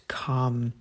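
ordinary talk

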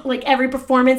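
normal talking voice

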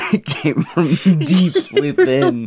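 slow, high, through laughter; laughing. Real, not acted. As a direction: talk while cracking up. Giggling about a cough.

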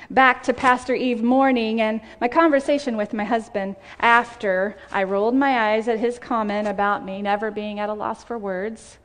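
normal talking voice

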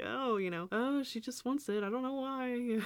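high-pitched playing dumb; imitated innocent explaining. Mom explaining to dad, mock-innocent excuse. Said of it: "kind of explain that to my dad".

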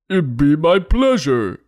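high, slow cartoon Minotaur voice; Melvin. Minotaur agreeing to give directions, high slow cartoon voice. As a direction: put on a drawn-out, hammed-up character voice. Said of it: "Melvin told her".

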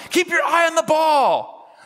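high, drawn-out mock yell; imitated dad yelling. Dad at Little League games, imitated high yelling. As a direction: holler it like a sideline dad. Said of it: "who just keeps on yelling".

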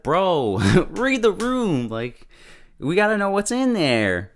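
high, drawn-out disbelief with a laugh; half-laughing exasperation at someone clueless; exasperated retort. Retold or imitated. Retelling exasperated retort to Kentaro.

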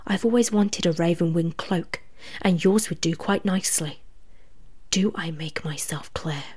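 cold, hushed threat; menacing threat. Acted. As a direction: threaten him quietly, almost whispering. Claws at his throat, threatening.

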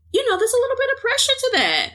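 very high, fast squeaky voice; high-pitched rushed fake fluster; squeaky mock panic. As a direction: squeak it out like a cartoon nervous wreck. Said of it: "All good pressure. It's exciting".

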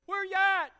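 the lament bursts into a shout; anguished cry. Acted. Preacher voicing David, crying out loud to a silent God.

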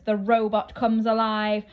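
ordinary delivery, nothing marked

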